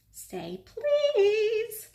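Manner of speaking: high, slow sing-song; teasing poltergeist. A narrator's reading. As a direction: taunt him slyly.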